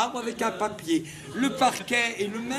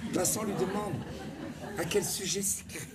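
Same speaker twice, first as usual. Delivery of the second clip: much softer, almost murmured; the same verse, now nearly whispered; same line, softly; 2 semitones lower, 6 dB quieter than usual. Shown as a demonstration. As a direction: pull it right back to a hush. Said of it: "La même phrase".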